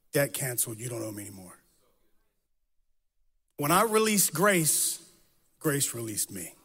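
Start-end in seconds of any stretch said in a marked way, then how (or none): 0.1-1.6 softer, sincere words to a father, trailing low; tender, hushed. Retold or imitated. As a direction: say it gently to a father you are forgiving. Telling his father face to face.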